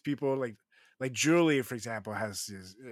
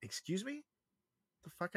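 mock disbelief; 9 semitones higher, 7 dB quieter than usual. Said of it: "and I'm like".

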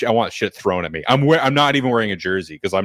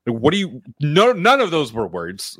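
mock bafflement; 6 semitones higher than usual. Imagined baffled listeners of Scottish speech.

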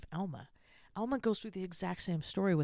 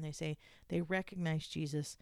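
normal talking voice

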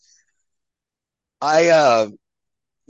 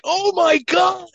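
much higher, slowed mock gasp of horror; mock shocked friends; 5 dB louder, slower than usual. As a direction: squeal it like appalled onlookers. Friends react to the tooth.

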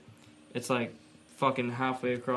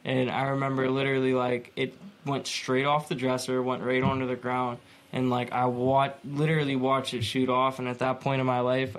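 ordinary talk, nothing marked

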